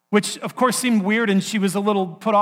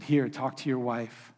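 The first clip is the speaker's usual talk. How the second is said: calm and gentle; 6 semitones lower, 9 dB quieter, slower than usual. Retold or imitated. I want you to be calm.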